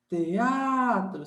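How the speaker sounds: TEATRO with a long, held A; shout technique. Shown as a demonstration. Teacher repeating TEATRO to separate E from stretched A, shout technique. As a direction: draw out the A in TEATRO as if calling afar. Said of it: "a técnica do grito".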